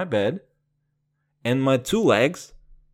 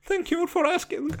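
much higher, prim squeak; faster than usual. Retold or imitated. Teenage him answering the girl, high mock-prim voice. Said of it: "And I'm".

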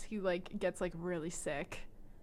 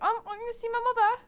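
put-on high, fast, louder posh British girl; British accent; 11 dB louder, faster than usual. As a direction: rush it in a mock British accent, much higher. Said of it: "Wait, she's not British".

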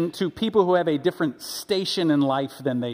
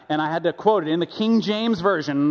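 usual speaking voice

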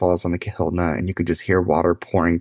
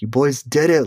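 imitating a thrilled pilot's higher-pitched congratulations; psyched; 7 semitones higher, 3 dB louder than usual. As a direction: cheer them on, voice jumping up, as retold. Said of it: "he was so psyched".

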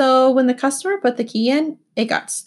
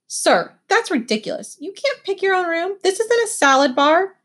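losing temper; 8 semitones higher than usual. Acted. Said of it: "That's when I lost it".

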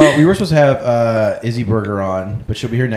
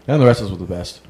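ordinary talk, nothing marked

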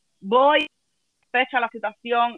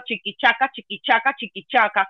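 train chugging. Retold or imitated. Imitates train sound.

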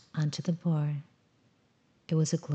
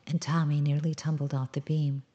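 usual speaking voice

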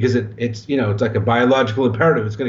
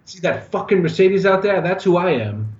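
raised, higher mock tough-guy yell; Alec Baldwin yelling; 7 semitones higher than usual. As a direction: do Baldwin's sneering brag, pitched up. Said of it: "when Alec Baldwin yells at the guy".

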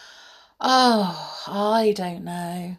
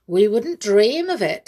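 louder, a sly grinning promise; with a grin; 3 semitones higher, 6 dB louder than usual. Acted. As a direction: grin through the words, teasing. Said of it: "said Tom with a grin".

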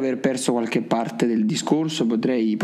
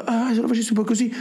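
higher, pleased self-quote; musing self-quote; 9 semitones higher, faster than usual. Retold or imitated. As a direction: act out a happy idea. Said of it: "e poi".

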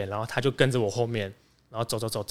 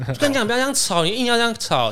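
higher, mocking nag; mock high bickering; 10 semitones higher than usual. Retelling the office squabble with a mocking high voice. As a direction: snipe back half laughing. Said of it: "互喷这样子".

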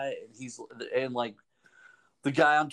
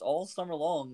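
ordinary talk

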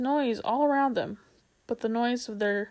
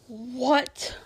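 usual speaking voice

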